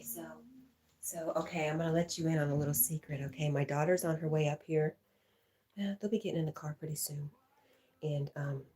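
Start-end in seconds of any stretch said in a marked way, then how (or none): none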